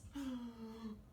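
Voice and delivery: audible drawn-in breath of shock; mock gasp. Storyteller reacting to Turkey as main course, mock gasp. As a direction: gasp for effect.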